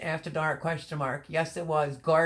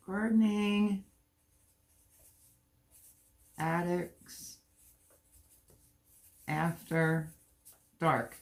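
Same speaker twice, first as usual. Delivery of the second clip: word by word, long pauses between; drawn-out words, paced to a pen; writing it out; slower than usual. Real, not acted. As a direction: spell it out slowly as you write. Saying words while writing them down.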